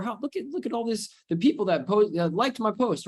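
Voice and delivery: high, giddy mock amazement; excited showing off. Coach mimicking someone thrilled by likes on a post, excited. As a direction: marvel at the attention, pitched well up. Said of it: "you can get excited".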